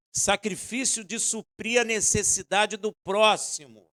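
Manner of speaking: loud, slow, emphatic sermon delivery; preaching with force; full-force preaching. Acted. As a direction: drive each phrase home to the congregation.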